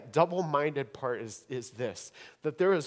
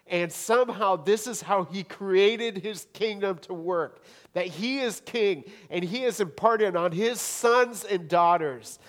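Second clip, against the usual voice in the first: rising, louder preaching voice, higher pitch; full-force preaching; 6 semitones higher, 8 dB louder than usual. Acted. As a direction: build the sermon up to a ringing climax.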